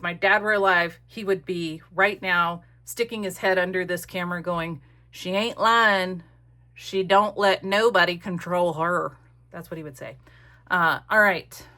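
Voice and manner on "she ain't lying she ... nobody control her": slow Appalachian drawl imitating her dad; a light Appalachian impression of her late father; Appalachian accent. Dad vouching for her, slow in an Appalachian accent. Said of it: "In his Appalachian accent".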